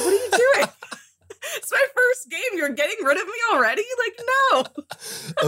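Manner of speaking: high-pitched mock-outraged yelling; retold indignant yell. Player to friends selling off her character, mock-outraged yell. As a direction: laughingly act out yelling at your friends. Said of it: "I yelled at everyone".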